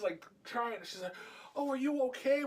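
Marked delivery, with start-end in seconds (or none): 1.6-2.4 fake concern. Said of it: "she's trying to pretend like".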